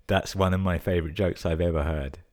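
ordinary delivery

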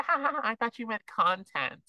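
put-on high, giggly ditzy girl voice; ditzy girl laugh. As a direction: laugh it off like an airheaded influencer. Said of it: "she's like".

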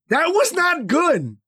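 high, drawn-out THAT WAS NOT GOOD; emphatic admission. Real, not acted. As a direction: stretch each word of "That was not good", pitched well up. Emphatic admission of own bad behaviour.

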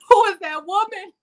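high, far-off shocked voice; mock horror at own reflection; shocked at the mirror. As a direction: gasp at a stranger in the mirror. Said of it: "you be like".

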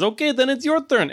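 put-on high-pitched accented villain voice; high villain voice with a foreign accent; hooded torturer. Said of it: "And he says".

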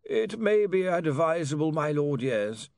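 high voice sinking as he concedes; sighing counsel to his lord; reluctant lawyer Slant. Acted. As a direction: advise wearily, starting high and falling. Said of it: "Mister Slant sighed".

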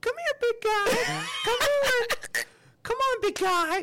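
very high, loud, slow falsetto calling; sing-song falsetto coax to a bird; high-pitched owl call. Shown as a demonstration. Showing a friend how he called his owl, loud falsetto call. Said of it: "This is how I did it".

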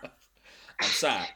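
blunt dismissive reply, imitated; blunt retort. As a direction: flatly shut him down, imitating. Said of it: "that kind of classic".

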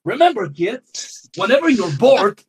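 high cartoon voice giving kids advice; mock Sonic lesson. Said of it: "Sonic the Hedgehog has said".

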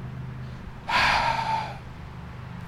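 long audible inhale and sigh; deep breath in. Retold or imitated. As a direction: draw a big breath and let it out. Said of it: "Francis was like".

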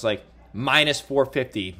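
ordinary talk

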